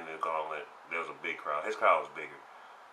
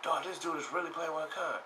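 amazed crowd; 9 semitones higher, 4 dB quieter than usual. Retold or imitated.

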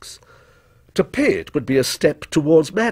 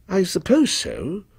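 slow, higher-pitched, hesitant agreement; doubtful; 6 semitones higher than usual. A narrator's reading. Distracted customer answering the dealer, doubtful. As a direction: agree slowly, without conviction. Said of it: "he said doubtfully".

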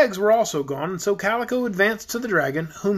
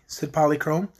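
ordinary delivery, nothing marked

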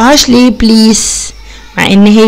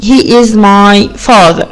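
slow and clear; slow model answer; 2 semitones lower, much slower than usual. Shown as a demonstration. Teacher modelling the answer slowly for pupils. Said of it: "يبقى هو إيه".

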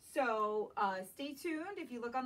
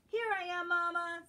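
higher, bright little cub voice; Baby Bear; 6 semitones higher, 5 dB louder than usual. Acted. Baby Bear revealing his hiding spot to Mama, higher playful. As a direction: pop out cheerfully from hiding. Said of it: "says Baby Bear".

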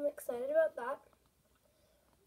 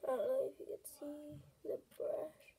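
soft whispered aside; whispering; 3 semitones higher, 6 dB quieter than usual. Real, not acted. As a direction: whisper it to the camera.

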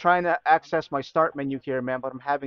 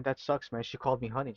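ordinary talk

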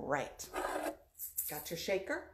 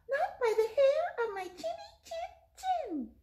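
squeaky high little pig; 9 dB louder than usual. Acted. Pig answers the wolf.

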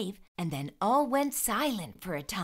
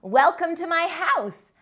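put-on bright, high welcome to young readers; upbeat storytime welcome; cheery host greeting; 7 semitones higher, 6 dB louder than usual. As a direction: greet the kids like a children's show host.

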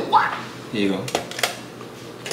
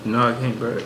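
usual speaking voice

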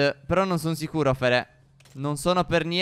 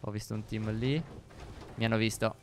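low-pitched and soft; stealthy hush; 7 semitones lower, 7 dB quieter than usual. Real, not acted. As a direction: keep it down so the enemy won't notice. Sneaking, hopes not to be noticed.

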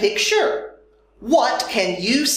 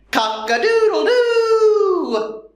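rooster crow; 9 semitones higher than usual. Pretend. Rooster call after naming chicken.

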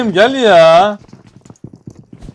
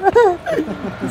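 high laugh; 13 semitones higher, 3 dB quieter than usual. Real, not acted. Laughing at enemies.

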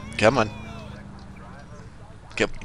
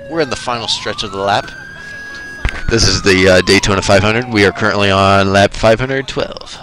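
louder mock race-commentator call; race announcer; 4 dB louder than usual. Treating the highway chase as NASCAR, loud mock announcer. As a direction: announce it like a stadium speedway caller.

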